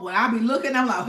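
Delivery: normal talking voice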